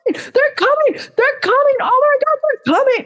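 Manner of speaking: very high-pitched, frantic squeal of alarm; panicked yelling. Acted. Prefrontal cortex yelling at the martial artist under attack, panicked. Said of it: "is just yelling at you".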